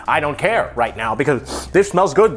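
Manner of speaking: ordinary talk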